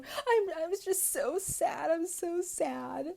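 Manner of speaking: high, slow, whiny mock sob voice; mock whiny sadness. Mocking Lisa whining sadly to John on the phone. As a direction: drag out sad like a pouting drama queen.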